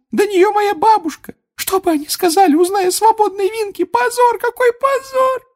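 loud, very high tearful elf lament; wailing Winky. A narrator's reading. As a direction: wail in a squeak, distraught for your master. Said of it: "опять зарыдала".

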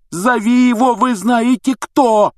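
high, loud, slow angry shout; angry outburst. Acted. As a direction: explode at him, dragging the words. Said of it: "взорвался Рон".

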